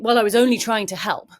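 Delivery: loud, exasperated self-defence; frustrated rescuer. Acted. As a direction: protest loudly, as an acted unappreciated helper. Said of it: "you end up both becoming really frustrated".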